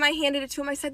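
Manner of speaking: ordinary delivery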